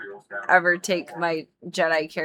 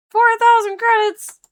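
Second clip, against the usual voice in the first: much higher and louder, stunned by the price; shocked outcry; 8 dB louder than usual. Real, not acted. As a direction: yelp the price in disbelief.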